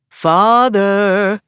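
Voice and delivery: loud, obnoxious sing-song call. Acted. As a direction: sing it out to annoy him. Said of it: "sing-songs someone in a loud and obnoxious voice".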